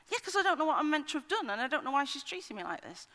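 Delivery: put-on high, upset teenage girl voice; teen daughter. Mother imitating her angry teenage daughter in the car, high and upset.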